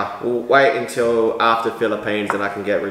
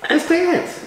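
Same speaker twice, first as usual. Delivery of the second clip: playful high. Pretend. Teasing partner to just dance.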